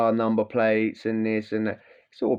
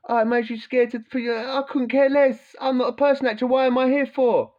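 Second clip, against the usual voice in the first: mock indignant rant; 11 semitones higher than usual. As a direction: act out scoffing disbelief at the course. Retelling his rant in the course.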